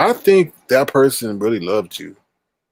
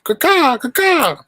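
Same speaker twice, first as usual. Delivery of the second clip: eagle call; 4 dB louder, slower than usual. Pretend. Eagle cry.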